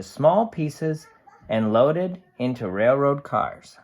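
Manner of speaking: lively storybook voice for a can's diary; cheerful picture book narration; reading to children. A narrator's reading. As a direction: read it bright and animated to a class. Reads picture book aloud.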